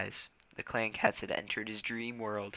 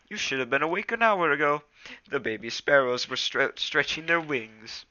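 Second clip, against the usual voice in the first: lightly voiced cat friend, higher and louder; a chiding pal, pitched up a little; Smudge the cat, chiding; 5 semitones higher, 7 dB louder than usual.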